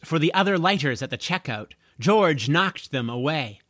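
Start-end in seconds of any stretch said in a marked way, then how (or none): none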